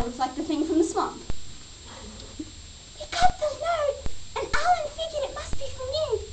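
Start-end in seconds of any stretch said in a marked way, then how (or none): 3.0-6.2 very high-pitched, irritating mimicry of a rival; mock her in a squeaky chipmunk voice. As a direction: chipmunk on acid. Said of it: "she has the most irritating voice".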